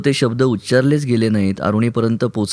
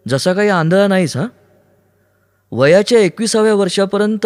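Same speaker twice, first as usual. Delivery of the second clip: high-pitched, heated tirade; bitter rant; 7 semitones higher than usual. Acted. Aruni rants as Siddharth about the blind fool, bitter. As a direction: rail at him in a raised voice.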